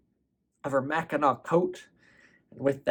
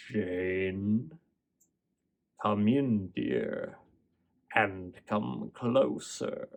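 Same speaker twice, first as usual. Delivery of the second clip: lower, softer, sly wolf voice, slow; wolf posing as grandma; 4 semitones lower, 7 dB quieter, much slower than usual. Acted. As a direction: coax her closer in a low, slow hush.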